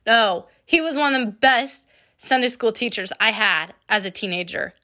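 ordinary talk

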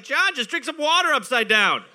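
mock exasperated rescuers. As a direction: talk to John like helpless bystanders. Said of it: "The two men were like".

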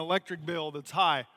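ordinary delivery

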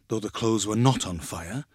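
plain reading voice

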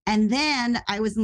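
usual speaking voice

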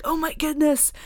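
high-pitched retold gasp of excitement; excited fangirl reaction. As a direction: relive a fan's thrilled surprise. Acting out reaction to new chapter.

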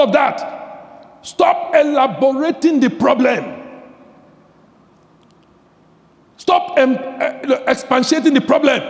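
loud, high, driving sermon voice; preaching at full force. Acted. Pastor rousing the congregation, voicing Jesus: full-force preaching. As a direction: hammer it home to the congregation.

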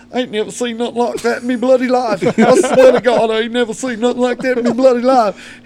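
high, loud Aussie cowhand, stunned; Australian accent. Retold or imitated. Todd the Australian cowhand reacting to the fight, shocked Australian accent. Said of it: "old Todd's sitting over there in the corner going".

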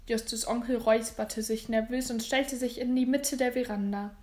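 ordinary delivery

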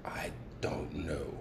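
faint unvoiced whisper. Real, not acted. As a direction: let it fade out in a whisper. Trails off in a whisper.

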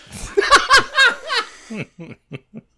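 loud, high burst of laughter; big laugh. Real, not acted. Guest laughing loudly at his own folksy insult. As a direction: crack up hard at your own joke.

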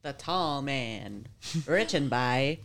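slow, dramatic title reading; story title read. Acted. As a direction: announce it slowly, spookily.

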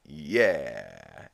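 low, falling mock cheer; cheer from the dirt. Peter embedded in the dirt, sinking mock cheer. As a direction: let the "yeah" sink downward. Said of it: "embedded in the dirt, you just hear him".

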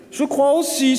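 high, self-satisfied agreement; one-upping boy. A narrator's reading. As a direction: chime in to top the praise. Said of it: "surenchérit Martin".

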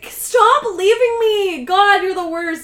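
high, loud, drawn-out complaint; exasperated girlfriend. Retold or imitated. Retelling girlfriend's complaint to him, exasperated mock wail. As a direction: whine it loudly as a fed-up girlfriend.

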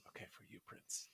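whispered, giving in to a charming pupil; smitten teacher. Acted. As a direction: imitate a teacher whispering a secret favour. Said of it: "I think the teacher would be like".

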